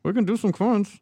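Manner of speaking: high, hollow voice in a cup; talking into a cup. Real, not acted. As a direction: speak like into a glass. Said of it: "What's going on with your voice?".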